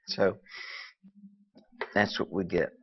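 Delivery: short of breath, breathy phrases; running out of air. Real, not acted. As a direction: talk while out of breath. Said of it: "I have no idea why I'm running out of breath".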